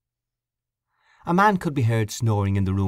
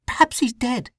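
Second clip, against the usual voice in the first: gasped. Acted. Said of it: "she gasped".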